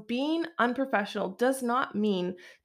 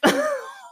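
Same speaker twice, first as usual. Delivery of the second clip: quick high laugh; 5 dB louder than usual. Real, not acted. Laughs mid-sentence.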